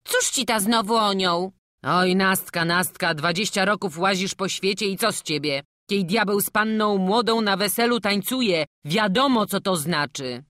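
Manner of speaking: scolding villager. Acted. Villager chides Nastka about the devil at the wedding, loud scolding. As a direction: scold the silly girl loudly.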